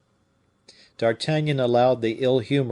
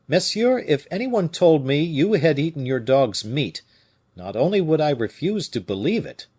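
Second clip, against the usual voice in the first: raised, higher-pitched indignant reprimand; sharp rebuke; 5 semitones higher, 5 dB louder than usual. A narrator's reading. D'Artagnan rebukes the keeper for speaking ill of Fouquet, sharply. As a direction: scold him forcefully, higher and emphatic. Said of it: "having undergone this sharp rebuke".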